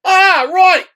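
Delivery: hammed-up English cameraman calling out to the crew; big, bright British impression; loud British accent. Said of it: "British guy with an inimitable accent".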